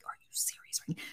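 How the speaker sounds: exasperated whisper; whispered exasperation. Retold or imitated. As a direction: hiss it quietly so the crew can't hear. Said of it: "And I'm like".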